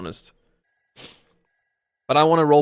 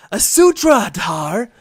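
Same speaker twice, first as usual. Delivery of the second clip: surprised; 6 semitones higher, 11 dB louder, slower than usual. Acted. Ghost greets the arriving narrator.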